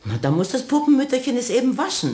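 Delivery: put-on high, quick, talking down as if to a child; patronising. Saleswoman humours the carpenter about the doll, patronising. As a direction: humour the strange old man.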